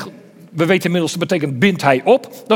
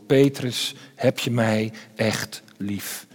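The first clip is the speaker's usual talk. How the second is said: quiet, slow question full of hurt from betrayal; pained; 8 semitones lower, 6 dB quieter, slower than usual. A narrator's reading. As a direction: ask it softly and slowly, carrying the pain of betrayal. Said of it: "alle pijn van het verraad klinkt erin door".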